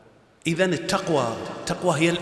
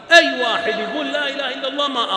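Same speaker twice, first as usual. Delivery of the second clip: much higher and louder, incredulous; outraged emphasis; 8 semitones higher, 4 dB louder than usual. Real, not acted. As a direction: throw the phrase up in disbelief. Preacher mocks Usama's oath.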